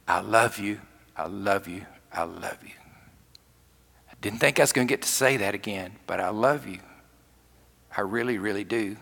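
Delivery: hushed, low confession of love, retold; Peter. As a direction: confess it softly and tenderly, fading as it repeats.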